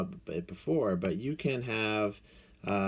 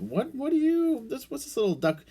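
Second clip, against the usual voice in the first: mock puzzlement; 13 semitones higher than usual. Puzzled by the duck.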